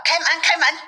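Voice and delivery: high-pitched demand at the door; narrated shout to be let in; calling out. A narrator's reading. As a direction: call out insistently, pitched well up. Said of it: "叫道".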